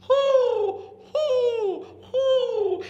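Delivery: high, loud owl hoots with a laugh; owl hooting. Retold or imitated. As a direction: hoot like an owl in the night. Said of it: "the owl added his voice".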